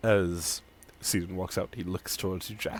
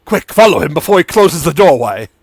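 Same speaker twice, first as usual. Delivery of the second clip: urgent merchant; 4 semitones higher, 11 dB louder than usual. Acted.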